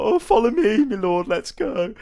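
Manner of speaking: high, put-on simpleton villager; eager peasant voice. Villager leads the Witcher off, eager peasant voice. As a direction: urge him along in a comic high voice.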